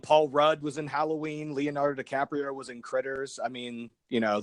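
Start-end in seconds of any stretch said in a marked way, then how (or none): none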